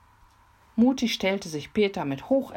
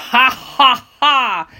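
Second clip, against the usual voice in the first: booming, far louder villain laugh; terrible laugh; 9 semitones higher, 14 dB louder, slower than usual. Acted. Robber laughing horribly at Mimi. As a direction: cackle even worse. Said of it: "lachte Fiolito noch fürchterlicher".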